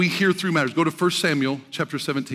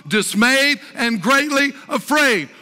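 higher, driving sermon voice with drawn-out key words; impassioned preaching; 7 semitones higher, 4 dB louder than usual. Real, not acted. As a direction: preach it out emphatically to the congregation. Preaches at full force.

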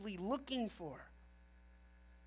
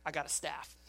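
sheepish; 6 semitones lower, 6 dB quieter than usual. Acted. Moses sheepishly answers angry crowd.

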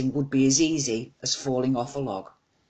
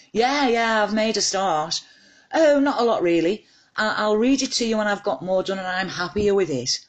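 bashful on the phone; 6 semitones higher than usual. Acted. A daughter telling her mum about the book, high and bashful. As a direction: play it down, all flustered.

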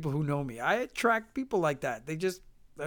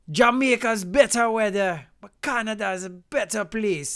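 louder, higher Jamaican voice; Jamaican accent; 4 semitones higher, 5 dB louder than usual. Retold or imitated. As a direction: imitate a Jamaican man's lively speech. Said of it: "behind me was this Jamaican guy".

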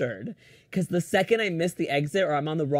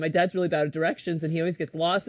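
usual speaking voice

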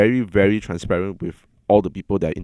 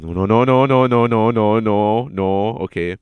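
louder pleading no's, holding in tears; fighting back tears; 4 dB louder, slower than usual. Retold or imitated. At dinner in public, fighting back tears. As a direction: chant no to stop yourself crying. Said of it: "I could feel the tears coming out".

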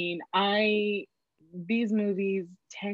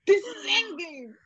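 retold outburst, pitched way up; high-pitched protest; 13 semitones higher than usual. Girlfriend telling her boyfriend why it matters, high-pitched retold protest. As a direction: protest it high, as if he should know.

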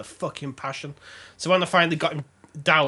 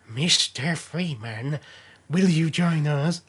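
very slow, stretched-out mysterious offer; G-Spot man; much slower than usual. Acted. G-man parody inviting the player to join, slow and deliberate. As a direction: draw every word out, ominously measured. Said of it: "The G-Spot man".

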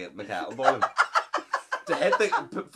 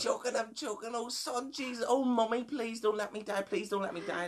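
high, pleading granny in mock panic; Granny Margaret; 10 semitones higher, 5 dB quieter than usual. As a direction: beg for life as an imitated choking granny. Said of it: "It's a very spot-on impression".

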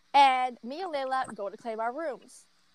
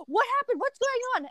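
higher, concerned mom voice; worried mom; 12 semitones higher, faster than usual. Retold or imitated. Retelling her mom asking what happened, in a higher worried voice. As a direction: ask like an alarmed mother. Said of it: "and she's like".

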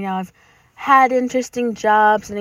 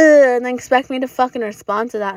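ordinary talk

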